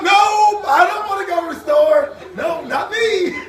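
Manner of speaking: high, loud whiny shouted no; loud refusal. Retold or imitated. Kids refusing mother's store trip, shouting laughingly. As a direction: refuse like a frightened kid. Kid refusing car ride.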